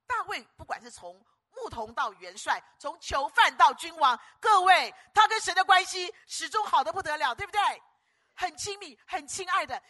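high, loud preacher's cry; preacher rising to a shout; full-force preaching. Real, not acted. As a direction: fire up the congregation.

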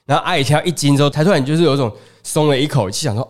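ordinary talk, nothing marked

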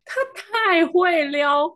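high, drawn-out exclamation; amazed. Real, not acted. Telling a friend about the flirt, slow and amazed. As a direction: stretch it out in awe.

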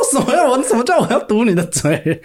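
高声不服气地反问; 模仿自己当时高声质问; 生气反问. Retold or imitated. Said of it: "我就超生气". (学自己生气地高声反问)

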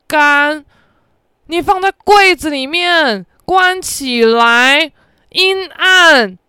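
loud, slow, exasperated scolding; exasperated shout. Retold or imitated. Friend yelling at the roommate over her mistake, loud and slow. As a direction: spell it out loudly like to a fool.

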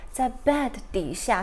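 ordinary talk

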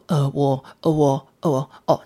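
imitating stammer. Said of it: "他就会突然间变结巴人这样".

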